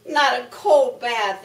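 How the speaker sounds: loud moan of protest; moaning cow. Acted. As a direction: complain loudly like an old cow.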